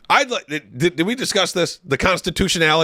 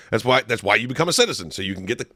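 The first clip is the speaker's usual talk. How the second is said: normal talking voice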